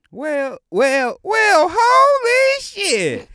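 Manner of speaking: loud, high Southern cartoon character; Sandy Cheeks. Cartoon newcomer finding herself underwater, loud high character voice. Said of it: "gotta get my higher register".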